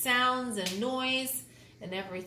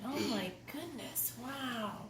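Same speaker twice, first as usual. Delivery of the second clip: soft awed whisper-like wow; hushed amazement; 9 dB quieter than usual. Real, not acted. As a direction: breathe it in wonder.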